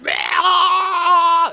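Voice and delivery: loud, high shrieking animal cry; a mock screech of a jungle beast; imitated animal scream. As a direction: let rip a piercing creature screech. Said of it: "Loudest scream I'd ever bloody heard".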